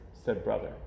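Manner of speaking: ordinary delivery, nothing marked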